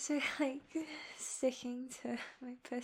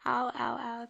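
pained ow; 2 semitones lower, 4 dB louder than usual. Real, not acted. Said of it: "That hurt really bad".

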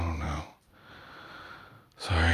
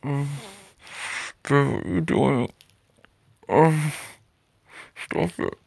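scared gasps; 9 semitones higher, 3 dB louder, slower than usual. Acted. Startled, scared.

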